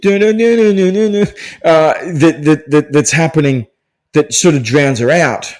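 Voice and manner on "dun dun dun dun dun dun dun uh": high, loud mock theme hum; humming cop music. Imitating the New York cop music in a review, high and loud. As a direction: sing the cop show sting. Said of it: "the New York cop music".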